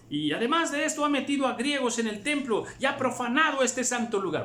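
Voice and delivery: acusación aguda y alzada; voz acusadora alzada. A narrator's reading. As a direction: denunciar al forastero con voz alta. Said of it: "Y dice". (voz alta y acusadora)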